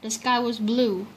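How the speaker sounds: ordinary delivery, nothing marked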